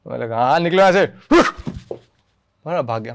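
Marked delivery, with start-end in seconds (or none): 0.4-1.1 loud, higher mock-shout of the punch line; loud stage shout. Actor replaying his stage line, a loud mock shout.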